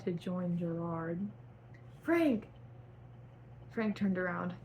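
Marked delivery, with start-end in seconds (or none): 2.0-2.5 calling out. A narrator's reading. Calling out to someone.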